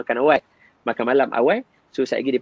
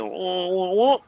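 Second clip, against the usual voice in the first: ordinary delivery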